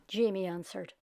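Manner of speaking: plain reading voice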